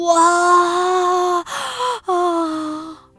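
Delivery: high loud cry of surprise; startled cry. Acted. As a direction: yelp as you trip. Cat trips and falls.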